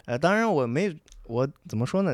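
ordinary talk, nothing marked

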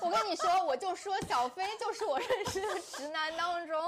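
high, talking through laughter; laughing. Real, not acted. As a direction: laugh while speaking. Host talks through laughter.

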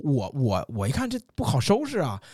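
usual speaking voice

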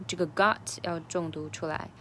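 ordinary delivery

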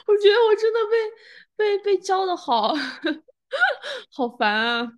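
high-pitched, giggly whine; laughing, almost wailing at oneself; laughing in mock exasperation. Real, not acted. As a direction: complain through helpless laughter. Laughing at own childish reflex.

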